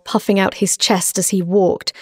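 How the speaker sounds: plain reading voice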